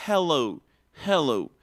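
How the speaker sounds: "hello" with a breathy h from a slightly closed glottis; aspirated h. Shown as a demonstration. Teacher shows the aspirated h in hello. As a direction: push air through the h of "hello". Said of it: "la consonante aspirada".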